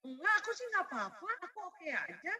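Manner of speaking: put-on high, light voice of a shrugging kid; child's voice. Mother imitating her child saying it's fine, high and light. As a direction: play a child brushing it off. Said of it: "anak-anak ada yang gini".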